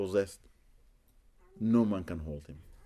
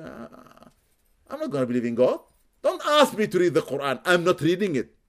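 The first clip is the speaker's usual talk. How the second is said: defiant refusal; 7 semitones higher, 7 dB louder than usual. Retold or imitated. Possessed woman refusing the Quran.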